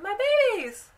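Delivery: high, loud wail of panic; mock-hysterical mother crying out; freaking out. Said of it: "is freaking out".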